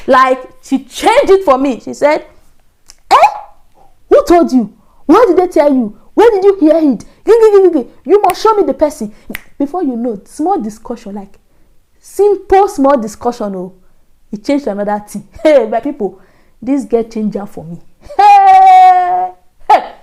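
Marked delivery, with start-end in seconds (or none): 3.1-9.1 high, loud outraged demand; mock screaming. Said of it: "She was screaming. She was shouting". 18.2-19.3 high, loud drawn-out "hey"; storyteller's dramatic high holler; long dismayed cry. Retold or imitated. Storyteller reacting to friend's outburst.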